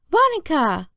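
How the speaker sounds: put-on high, insistent toddler call; little brother Jamie. A narrator's reading. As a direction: call the babysitter in a high child's voice. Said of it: "said Jamie".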